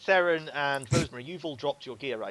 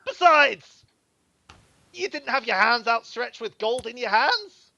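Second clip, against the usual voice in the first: much higher, loud scolding thug; Scarface voice; 11 semitones higher, 12 dB louder than usual. Acted. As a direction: lecture them on bandit business. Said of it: "he goes".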